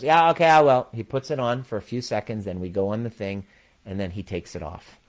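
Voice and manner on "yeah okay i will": high, loud careless agreement; dismissive brush-off. Retold or imitated. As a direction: toss it off, not meaning it. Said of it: "And he goes".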